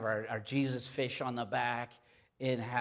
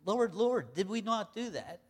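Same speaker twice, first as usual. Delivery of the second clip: pleading; 8 semitones higher than usual. Retold or imitated. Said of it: "you say".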